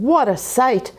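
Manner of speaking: usual speaking voice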